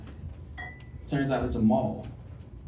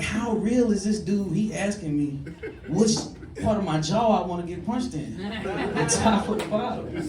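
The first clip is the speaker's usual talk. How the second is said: high, slow, baffled disbelief; a bewildered man asking himself in disbelief; incredulous inner voice; 6 semitones higher than usual. Retold or imitated. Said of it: "I'm like".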